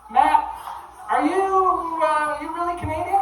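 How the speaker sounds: high incredulous mock voice; high mock questioning voice. Heckling people quoted in bit.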